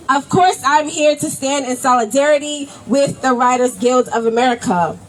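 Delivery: normal talking voice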